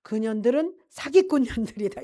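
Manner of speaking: loud, bitter condemnation; angry father. Retold or imitated. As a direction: spit it out at the shamans. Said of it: "우리 아버지가 막 너무 화가 난 거예요". (화난 아버지의 큰소리)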